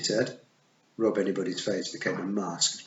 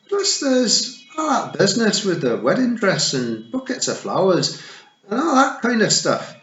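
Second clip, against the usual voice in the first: higher, louder cheerful Scottish pixie; Scottish Feegle; 12 semitones higher, 8 dB louder than usual. A narrator's reading. Rob Anybody listing wedding fuss to Tiffany, Scottish cheer. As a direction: rattle it off in a Scottish pixie brogue.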